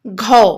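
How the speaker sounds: घौ pronounced ghau with breathy gh; syllable ghau. Shown as a demonstration. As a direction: model घौ for the class. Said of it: "घ पर औ की मात्रा". (घौ का उच्चारण करके दिखाएँ)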